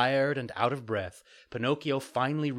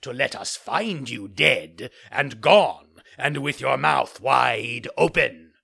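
loud, slow taunting villain voice; mockingly; 3 semitones higher, 8 dB louder than usual. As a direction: jeer at the hanging puppet. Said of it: "the assassins called to him mockingly".

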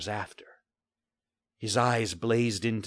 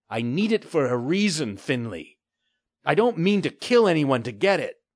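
put-on a young lad standing up to a pirate, louder; defiant boy; 7 semitones higher, 6 dB louder than usual. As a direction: push back hard at the pirate. Boy voice pleading firm.

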